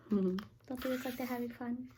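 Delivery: soft aside. Real, not acted. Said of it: "aside to child".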